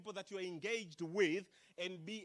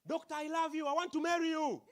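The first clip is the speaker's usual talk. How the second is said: higher, louder eager declaration; blurted confession; 7 semitones higher, 8 dB louder than usual. Retold or imitated. Stranger blurting love to the doctor, higher and louder. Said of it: "somebody comes to you and says".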